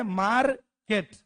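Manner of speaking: "Market" slowly chopped into मार, केट; drawn-out "market" with a gap before केट; split in two. Shown as a demonstration. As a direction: break "market" after a sounded r. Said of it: "दो भागों में बांट के postmortem कर दिया".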